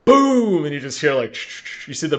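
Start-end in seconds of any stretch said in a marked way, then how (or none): 0.1-0.7 high, loud drawn-out boom; imitated gunshot boom. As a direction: mimic the shotgun blast with gusto. Imitates the shotgun blast in story.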